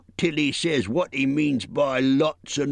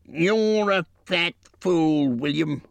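troll quarrel; 11 semitones higher, 4 dB louder, much slower than usual. A narrator's reading.

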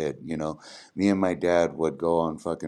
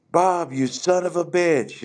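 higher, slow, drawn-out movie-villain hail; Tarantino-style greeting; 10 semitones higher, slower than usual. Retold or imitated. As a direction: greet him like a gangster in a Tarantino film. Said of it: "It was like a fucking Tarantino movie".

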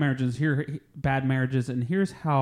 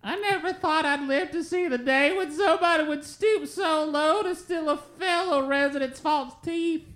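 put-on old lady voice. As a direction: quaver it like an outraged granny. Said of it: "Mildred Thompson ... has this to say".

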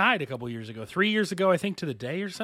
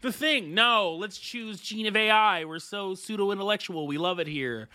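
high-pitched mock enthusiasm; high, sarcastic imitation of eager friends; 6 semitones higher than usual. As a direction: parody your own group's pretentious choice in a raised voice. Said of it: "we were like".

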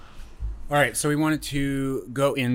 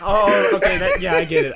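loud, high, laughing; laughing shout; 8 semitones higher, 5 dB louder than usual. Real, not acted. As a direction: burst out laughing loudly. Reacts to friend's tiny fortress.

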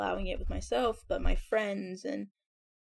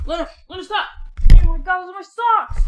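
high, loud scolding of the dog; loud exasperated yell; 10 semitones higher, 19 dB louder, faster than usual. Real, not acted. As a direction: shout as the dog steals socks. Dog grabbing socks.

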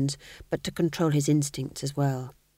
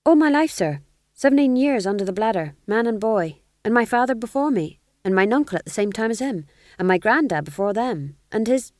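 higher, quick rattling jester; eager fool; 5 semitones higher, 5 dB louder than usual. Acted. Fool proudly listing his family of fools, higher and quick. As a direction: gabble it out proudly.

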